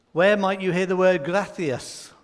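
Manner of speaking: ordinary delivery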